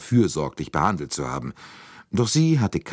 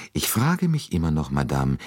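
usual speaking voice

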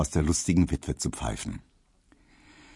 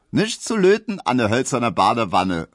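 a hint of a saucy Berlin retort, louder; Emma calling out; 5 semitones higher, 5 dB louder than usual. A narrator's reading. Emma shoots a cheeky retort at the postman, louder. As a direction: toss the quip back saucily. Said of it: "rief Emma".

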